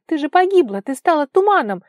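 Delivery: high, loud, agitated exclamation; astonished outburst. A narrator's reading. Girl thought Vara had died, astonished.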